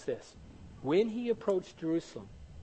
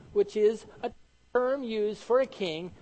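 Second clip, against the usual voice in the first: usual speaking voice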